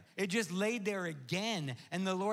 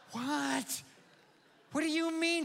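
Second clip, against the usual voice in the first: rising higher in mock shock; mock disbelief; 8 semitones higher than usual. Pastor retelling the vet's news, squeaking with mock shock.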